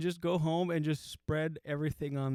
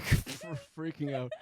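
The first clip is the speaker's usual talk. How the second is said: ordinary talk, nothing marked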